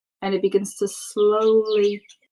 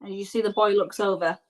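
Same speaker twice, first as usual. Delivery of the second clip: normal talking voice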